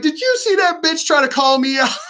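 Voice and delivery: high-pitched, hammed-up indignant disbelief; mock outrage. President ranting on a live stream at being called out, mock outrage. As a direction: play at being scandalized, pitch way up. Imagined presidential livestream outburst.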